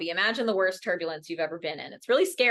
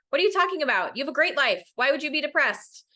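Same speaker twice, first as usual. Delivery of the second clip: dismissive parent; 7 semitones higher, faster than usual. Retold or imitated. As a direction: scoff at it as if it's nonsense. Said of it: "don't dismiss the problem".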